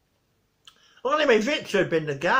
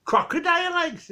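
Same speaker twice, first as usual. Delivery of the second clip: put-on Geordie accent; 12 semitones higher than usual. Geordie guy selling Victor eggs, thick Geordie accent. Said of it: "couldn't understand his accent".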